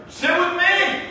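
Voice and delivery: high, drawn-out invitation from a stranger; friendly stranger calling over. Retold or imitated. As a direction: call them over to your table, high-pitched. Stranger calls them over to table.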